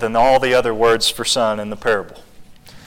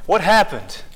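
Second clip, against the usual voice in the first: mimicking toddler; 5 semitones higher than usual. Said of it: "She just goes around saying it".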